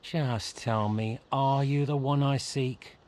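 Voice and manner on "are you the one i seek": the drawn out and slow; slow, pointed the; stress on the. Acted. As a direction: lean on the in are you the one I seek. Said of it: "And I emphasize the word".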